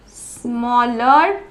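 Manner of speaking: "Smaller, smallest" stretched very slowly; slow ending demo. Shown as a demonstration. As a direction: draw out "स्मॉ" before each ending. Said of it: "आखिर में ईआर जुड़ जाएगा". ("Smaller, smallest" धीरे, खींचकर)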